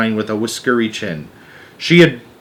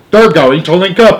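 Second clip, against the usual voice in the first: high, loud excited cry; excited shout; 7 semitones higher, 9 dB louder than usual. Acted. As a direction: shout it out in delight. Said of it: "shouted the president".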